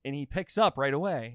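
ordinary talk

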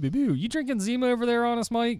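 high, fast squeaky stammer. Acted. High squeaky voice as he buzzes in to quiz his friend. Mock game show buzz-in.

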